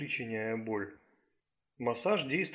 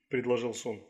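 ordinary delivery, nothing marked

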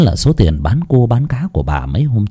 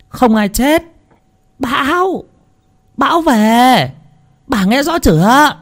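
very high, strained mock yell; high-pitched exasperated calling, word by word; shouting into deaf ear. A narrator's reading. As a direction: spell it out for someone hard of hearing. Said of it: "ghé sát tai mà nói như gào lên".

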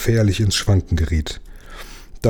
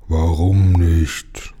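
very slow, low growling sea king; growled; 3 semitones lower, much slower than usual. Acted. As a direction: rumble it like a belching whale. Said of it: "grollte er".

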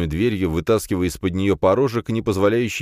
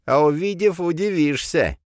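put-on sly old man; 12 semitones higher than usual. A narrator's reading. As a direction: taunt him knowingly. (хитрый старик, высоким скрипучим голосом)

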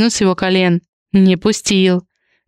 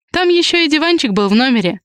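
higher-pitched, pert interjection; lightly butting in, pitch raised; interjecting; 6 semitones higher than usual. A narrator's reading. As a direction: cut in with an objection. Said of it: "вмешалась Ри в пламенную речь".

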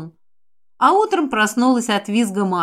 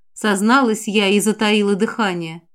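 ordinary delivery

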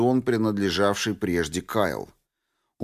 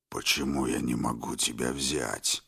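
deep, rasping mutant voice, far lower; hoarse bass; 10 semitones lower, 8 dB quieter than usual. Acted. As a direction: growl it low like a dull monster. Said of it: "хриплым басом спросил мутант".

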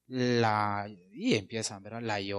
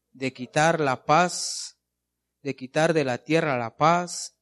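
emphatic scripture reading; 5 dB louder, slower than usual. Real, not acted. Preacher reads scripture aloud.

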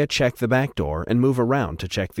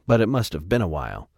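plain reading voice

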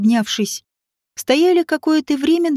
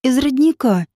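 mildly confused answer; a touch of bewilderment; bewildered. A narrator's reading. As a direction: answer as if at a loss. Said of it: "растерянно ответила".

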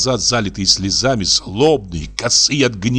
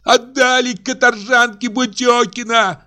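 much higher, tearful furious outcry; Aksinya screaming in tears. A narrator's reading. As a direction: wail out her grievance at the in-laws. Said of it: "продолжала Аксинья кричать". (рыдающий гневный крик, намного выше)

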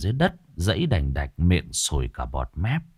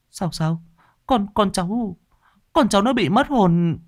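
much higher, stammering panic; panicked parent; 13 semitones higher than usual. Acted. Panicked father asking if his son lost his soul. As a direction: repeat the words in disbelief.

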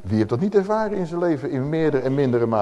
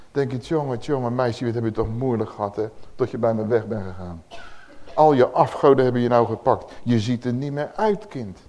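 softer, lower, gently pitying; tender fatherly voice; 5 semitones lower, 3 dB quieter than usual. Acted. God comforts the fallen child, soft and low. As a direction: speak softly to a lost child.

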